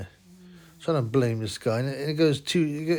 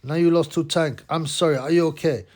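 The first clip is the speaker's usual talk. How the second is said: put-on Mongolian-accented broken English, a bit louder; mock Mongolian accent; 3 semitones higher, 4 dB louder than usual. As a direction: play the Mongolian fighter teasing in his accent. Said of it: "Tsen Batar says, like".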